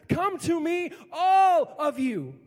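loud, slow, booming "all of you"; full-voiced proclamation, all held long; shouted invitation. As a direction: call out to everyone, stretching ALL. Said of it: "Jesus refutes this notion".

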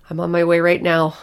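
loud, panicked shout into the phone; loud frantic yell; frantic scream. Acted. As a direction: yell it, desperate to get there. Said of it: "I practically screamed into the phone".

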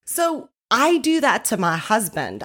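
ordinary delivery

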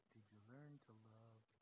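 very soft and low, reverent; soft and low, holding the awe; hushed quote. Retold or imitated. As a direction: nearly whisper the Lord's question. Quoting the Lord's question softly.

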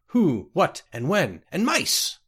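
high, loud, swooping question; odd creature voice. A narrator's reading. Unseen jungle voice questions a lost traveler, oddly high. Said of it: "oddly accented voice of indiscriminate gender".

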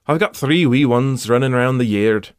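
a hammed-up Scottish accent, like a proud parent; cheerful bad Scottish accent; self-mocking amateur Scottish brogue. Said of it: "That was my best and worst Scottish".

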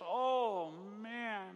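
high, slow whining complaint; moaning Israelite voice, drawn out; whiny Israelites. Retold or imitated. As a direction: whine like a hungry grumbling crowd. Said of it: "they say things like this".

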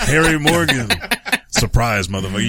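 lightly voiced Doakes, laughing; mock Doakes snarl; Doakes catchphrase.

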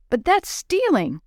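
high, slow protest of disbelief; open-mouthed shock, pitch leaping up. A narrator's reading. Willie realises the boys took the candy, shocked. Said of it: "Willie's mouth fell open".

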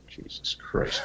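breathy whispered aside. Real, not acted. Host mutters after the heckle demo, whispering. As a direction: mutter it under your breath. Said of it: "whispered aside".

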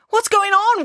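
high panicked wail. Acted. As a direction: cry it out in panic as the bus slides. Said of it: "wailed Georgia".

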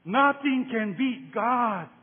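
bully declaring it's unbeatable; bully's slow boast. Retold or imitated. Bully boasting, later echoed by preacher.

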